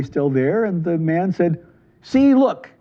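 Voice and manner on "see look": higher, louder eager point-out; eager "see, look". Acted. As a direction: show it off triumphantly, pitched up. Man shows dog can talk.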